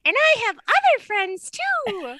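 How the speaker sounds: high-pitched squishy frog character; toy frog voice. Pretend. Playing a stuffed frog to comfort face-down patient, high frog voice. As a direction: pipe up as a friendly toy frog, drawn out. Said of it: "then the little frog said".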